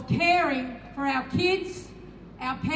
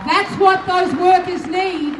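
rally shout; 2 semitones higher, 8 dB louder than usual. Real, not acted. Union rally speech, emphatic line.

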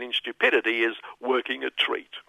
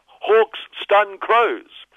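headline read; 7 semitones higher, 3 dB louder, much slower than usual. Acted. Reading headline mockingly.

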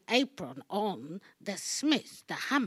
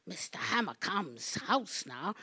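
normal talking voice

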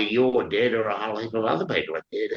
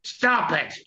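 yelled; 10 semitones higher than usual. Acted. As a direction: cry out to make him stop. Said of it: "Audrey yelled".

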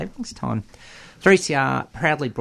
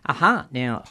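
usual speaking voice

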